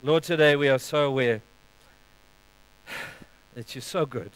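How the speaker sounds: soft, low, unhurried prayer voice. Acted. Pastor leading the congregation in prayer, hushed. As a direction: pray it quietly, with long pauses.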